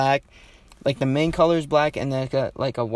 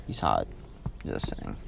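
much lower and softer, last words breathed out; hushed aside; 10 dB quieter than usual. Real, not acted. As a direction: drop your voice so the neighbor can't hear. Gossiping as neighbor looks over.

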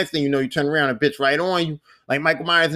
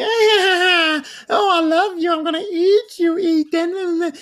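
villain daughter voice; 12 semitones higher, much slower than usual. Retold or imitated. Telling viewers how the vampire daughter taunted him, high creepy croon. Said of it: "She come out and she say".

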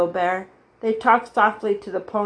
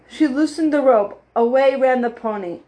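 plain reading voice

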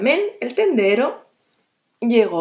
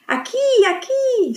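very high squeaky call, slow; tiny high call; 13 semitones higher than usual. Acted. Garbancito calling to the shopkeeper, very high squeak. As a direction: squeak up like a tiny boy jumping. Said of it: "saltaba Garbancito".

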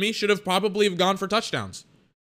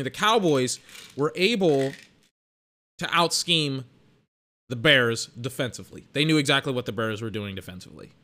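ordinary talk, nothing marked

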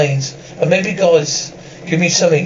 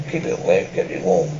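deep put-on voice; 9 semitones lower, 6 dB quieter than usual. Low put-on voice.

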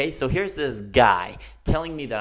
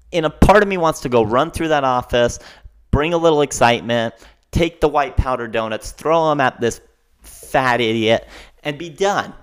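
loud, worked-up comic rant; livid; 6 dB louder than usual. Real, not acted. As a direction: let the rage build into a loud tirade. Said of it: "I'm now mad. I'm now livid".

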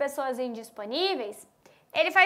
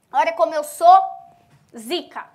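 loud, swaggering mock brag; mock show-off; 7 dB louder than usual. Mocking a show-off to her students, loud and cocky.

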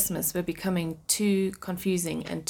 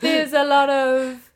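laughing; 8 semitones higher, 8 dB louder, slower than usual. Real, not acted. Laughing mid-sentence.

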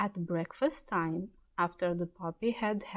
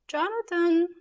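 a mother calling her son from the next room; raised, high call of the name; 10 semitones higher, 7 dB louder than usual. Acted. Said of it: "called his mother".

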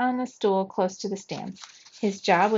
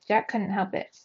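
ordinary delivery, nothing marked

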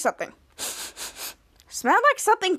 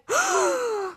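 long, high-pitched mock gasp; acted-out gasp of astonishment; shocked gasp; 11 semitones higher than usual. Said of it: "Chris and Jake were like".

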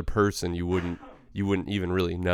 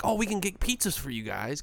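parents' voice; 9 semitones higher than usual. Retold or imitated. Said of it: "they'd be like".

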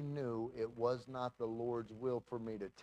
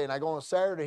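ordinary talk, nothing marked

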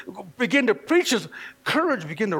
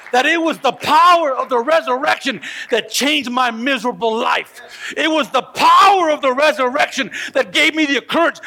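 louder, rising preacher's cry; fervent preaching; 5 semitones higher, 5 dB louder than usual. Acted. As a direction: preach it hard to the congregation. Preaching at full force.

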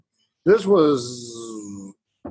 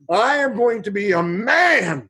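mock proud; 6 semitones higher, slower than usual. Proud of the toilet task.

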